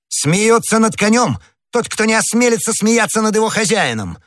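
put-on high, enraged young hothead; furious exclamation. A narrator's reading. Gascon challenging the man mocking his horse, furious. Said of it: "воскликнул в бешенстве гасконец".

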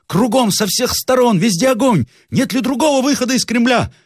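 very high, urgent general; frantic high-pitched exclaiming; alarmed cry. A narrator's reading. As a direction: cry out as if trapped by fire. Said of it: "вскричал генерал".

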